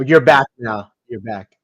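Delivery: ordinary talk, nothing marked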